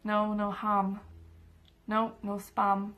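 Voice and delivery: slow, drawn-out list of refusals; weary slow repetition; exasperated listing. Retold or imitated. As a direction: spell it out patiently, one item at a time. Listing forbidden foods to waiters.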